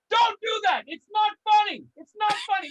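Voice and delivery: mock-stern scolding of joke tellers. Real, not acted. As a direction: tell the crowd off.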